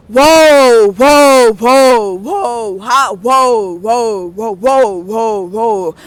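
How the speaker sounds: loud, mind-blown stoner whoas; acted stoned amazement; awestruck whoas. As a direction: blurt out whoa after whoa, marvelling. Acting out a stoned revelation.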